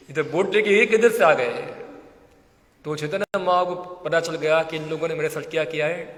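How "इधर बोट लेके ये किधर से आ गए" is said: loud mock astonishment; acted bewilderment. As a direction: question it loudly, imitating someone taken aback. Repeats Mahaprabhu's startled question. (हैरानी की नकल में ज़ोर से सवाल)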